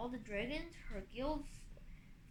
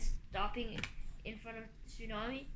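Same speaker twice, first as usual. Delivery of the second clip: ordinary delivery, nothing marked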